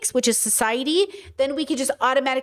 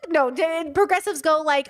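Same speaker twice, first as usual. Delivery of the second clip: ordinary talk, nothing marked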